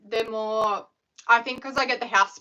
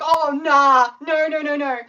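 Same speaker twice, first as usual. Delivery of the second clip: panicked no; 4 semitones higher, 8 dB louder, slower than usual. Retold or imitated. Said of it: "And I'm just like".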